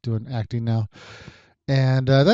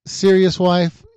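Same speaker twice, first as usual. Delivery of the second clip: raised, louder disbelief at his wife; mock incredulous; 6 semitones higher, 5 dB louder, much slower than usual. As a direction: challenge her in playful disbelief. Retold spat over the note.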